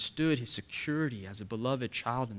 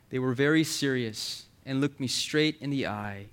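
normal talking voice

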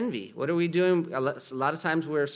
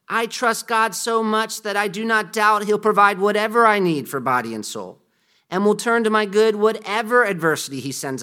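reads the creed aloud, louder and fuller than his chat; solemn sermon reading; 4 semitones higher, 5 dB louder than usual. Acted. Preacher reading the catechism to the church, solemn and full-voiced. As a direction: proclaim each clause of the confession to the congregation.

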